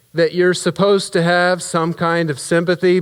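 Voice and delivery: ordinary talk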